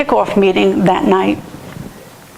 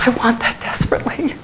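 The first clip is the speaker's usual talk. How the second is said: choked, whispered confession; tearful longing; 3 semitones higher, 4 dB quieter than usual. Real, not acted. Preacher confessing desperate want to trust God, tearful whisper. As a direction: whisper it, overcome with emotion.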